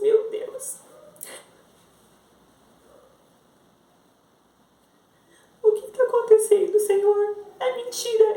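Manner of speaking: high-pitched plea to God, reliving the shock; retold anguished disbelief. Mother crying out to God after bad news, anguished disbelief. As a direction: relive a desperate, disbelieving prayer. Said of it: "Eu não acreditava".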